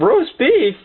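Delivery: high-pitched incredulous question; squeaky, puzzled query; baffled echo. Retold or imitated. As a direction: repeat it back in mock disbelief. Kids baffled by sleep talker.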